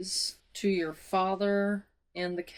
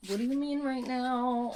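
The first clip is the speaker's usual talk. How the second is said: incredulous question pitched up; high-pitched disbelief; 5 semitones higher than usual. Real, not acted. Reader questions the plot twist, in high incredulous tones. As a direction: repeat it higher, in a whine of disbelief.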